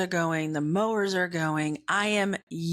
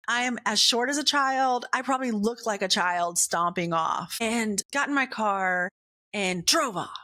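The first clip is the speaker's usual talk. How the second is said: normal talking voice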